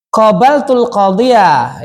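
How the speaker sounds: "Qabaltul qadiyah" read out slowly; slow model reading. Shown as a demonstration. (dibaca pelan sebagai contoh)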